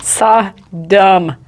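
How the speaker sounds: "Sodom" drawn out in two separate syllables; careful pronunciation. Shown as a demonstration. Host practising "Sodom" slowly, syllable by syllable. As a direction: break "Sodom" into SOD, um.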